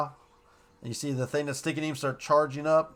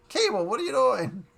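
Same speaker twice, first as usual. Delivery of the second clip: Madeline surprised; 8 semitones higher, 3 dB louder than usual. Retold or imitated. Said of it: "Madeline knew".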